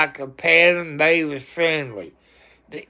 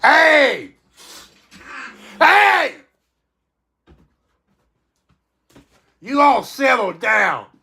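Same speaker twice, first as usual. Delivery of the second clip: high, loud shout at unruly pets; real yell to stop the cats fighting; 8 semitones higher, 3 dB louder than usual. Real, not acted. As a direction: shout them into line, real irritation. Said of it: "I had to yell at my cats".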